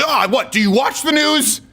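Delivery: high, slowed mock outrage; going nuts. Chicago guy challenging the speaker on Trump, going nuts. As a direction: imitate an incensed man, pitch way up. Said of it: "he starts going nuts".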